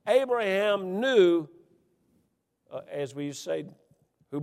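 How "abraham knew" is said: loud, slow, high preaching cry; preaching at full force. Acted. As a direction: preach it out, drawn long and loud. Preacher building to a peak.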